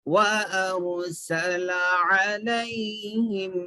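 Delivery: slow, measured tajweed chanting; Quran recitation. Shown as a demonstration. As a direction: draw out the long vowels, fading on the last. Recites verses from the start.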